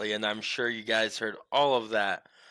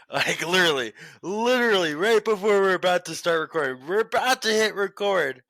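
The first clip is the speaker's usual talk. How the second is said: higher and louder, building up; exasperated; 8 semitones higher, 6 dB louder than usual. Real, not acted. Host complains to co-host, louder and higher with exasperation. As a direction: pile on the outrage.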